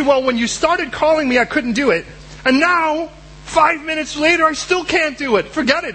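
high, fed-up complaint; a high, fed-up excuse; exasperated whine. Acted. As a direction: whine up high, then give up.

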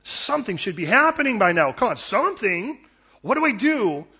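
antsy and agitated, pushing for an answer; restless, frustrated. Retold or imitated. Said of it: "I get restless".

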